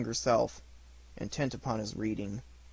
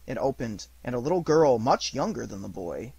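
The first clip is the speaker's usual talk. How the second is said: usual speaking voice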